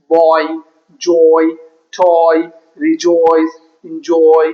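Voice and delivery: put-on boy, joy, toy, each with a full level "oy" glide; one word at a time from Boy to enjoy, pausing after each; O-Y word drill. Shown as a demonstration. Teacher drilling O-Y words, Boy to enjoy with sustained "oy". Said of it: "that is called ઓ ઈ".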